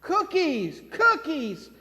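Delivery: put-on high child voice begging; little girl asking. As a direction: whine it like a toddler. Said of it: "the little girl kept asking".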